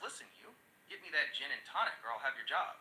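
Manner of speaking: ordinary delivery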